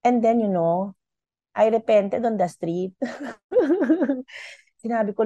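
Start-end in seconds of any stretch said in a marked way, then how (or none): 3.0-4.5 short bright laugh at herself. Real, not acted. Laughing at her own story of repenting on the street. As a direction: laugh it off, amused.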